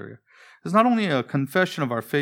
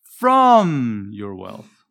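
"from" drawn out long and loud, then "your wealth" dropping away; stress on FROM; 3 semitones lower, 9 dB louder, much slower than usual. Shown as a demonstration. Preacher offering the congregation a first reading choice, stress on "from". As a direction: punch and stretch the first word "from". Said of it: "my own oral reading version".

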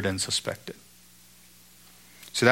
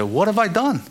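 indignant protest; 7 semitones higher than usual. Retold or imitated. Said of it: "He said".

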